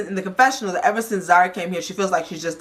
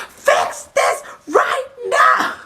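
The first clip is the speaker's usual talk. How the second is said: high, loud, drawn-out retold scream; furious outburst; 4 dB louder, much slower than usual. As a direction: imitate his explosion, screaming each word slowly. Acting out Swanky's angry outburst.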